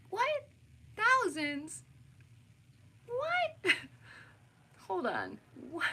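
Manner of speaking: high, loud incredulous shriek of What; shocked disbelief. Real, not acted. Vlogger hearing others get thousands, in shocked disbelief. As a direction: squeal the word in total disbelief.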